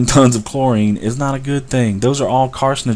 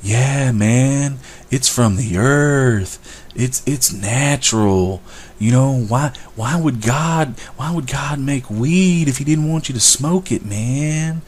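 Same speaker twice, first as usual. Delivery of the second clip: stoner impression; 3 semitones higher, 4 dB quieter, slower than usual. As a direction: play a laid-back stoner defending weed, dragged out. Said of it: "They're like".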